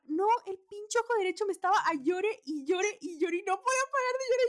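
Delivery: exasperated, pitch rising sharply; worked-up rant. Retold or imitated. Frustrated retelling of crying eye. (relato exasperado, con la voz subiendo)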